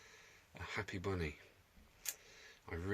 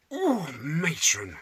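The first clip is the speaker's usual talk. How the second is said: slow, drawn-out camp "oh, matron"; camp innuendo; 6 semitones higher, 7 dB louder, much slower than usual. Pretend. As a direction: play the cheeky double meaning. Camp innuendo after "breathe.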